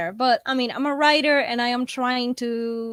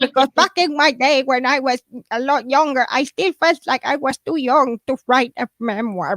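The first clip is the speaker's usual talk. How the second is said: put-on old grandma reminiscing; creaky old lady voice. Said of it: "that's my grandma impression".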